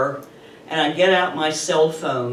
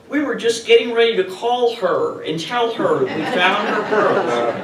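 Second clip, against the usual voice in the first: mocking laugh; 4 semitones higher than usual. Mocking thief's lame excuse.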